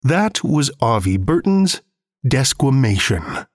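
ordinary delivery

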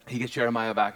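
normal talking voice

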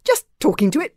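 put-on embarrassed boy explaining himself; embarrassed mumble. A narrator's reading. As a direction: own up awkwardly, embarrassed. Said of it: "mumbled Hiccup, very embarrassed".

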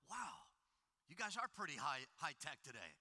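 low, soft, mildly amused God voice; a hint of God marveling from above; God looking down. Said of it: "looking down and going".

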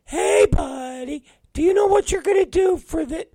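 high mocking falsetto; Will. Coworker quizzing his boss, high mocking falsetto. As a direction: pester in a squeaky, eager mimic voice. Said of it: "and he's like".

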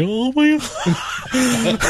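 slow, high, laughing "oh my God"; laughing dismay. Retold or imitated. Player watching the whining dog, slow laughing groan. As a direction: stretch it out through laughter. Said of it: "And I'm like".

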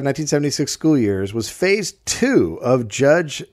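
usual speaking voice

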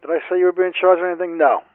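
impatient interrogator raising his voice; stern interrogation. Acted. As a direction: fire the question at the suspect, loudly. Interrogator snaps at suspect.

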